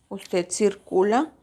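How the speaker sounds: ordinary talk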